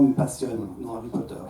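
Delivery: ordinary delivery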